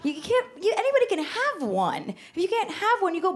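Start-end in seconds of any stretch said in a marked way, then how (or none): none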